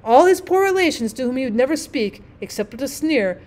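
usual speaking voice

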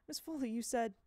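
ordinary delivery

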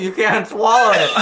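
high, laughing disbelief. Real, not acted. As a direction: laugh out the words. Eating the puke fruit.

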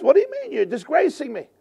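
high, outraged mock protest; indignant Bilam. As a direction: squeal out the offended complaint. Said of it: "But Bilam says".

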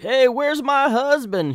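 high, loud, slow demand from a comic villainess; high imperious woman voice, slow. Retold or imitated. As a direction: play a haughty woman calling out for her husband. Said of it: "she's like".

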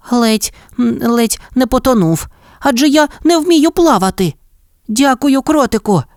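rescued hare still catching breath; grateful hare. A narrator's reading. Rescued hare thanks mole, high and shaken.

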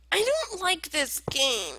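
fake-cry like a sulking kid; mock crying. Gamer losing, fake-crying in mock despair.

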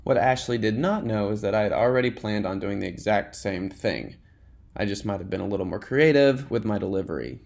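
ordinary delivery

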